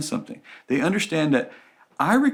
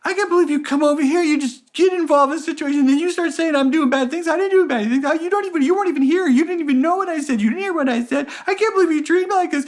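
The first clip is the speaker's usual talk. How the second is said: much higher, fast indignant whine; whiny bad guy; 14 semitones higher, 4 dB louder, faster than usual. Bad guy turning victim, whining at the rescuer. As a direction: rattle it off in a wounded rant. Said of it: "the bad guy says".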